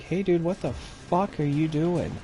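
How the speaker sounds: a retold hey-what-are-you-doing outburst; mock confrontation. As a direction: call out across the lot, half-mocking. Confronting guy dumping trash outside.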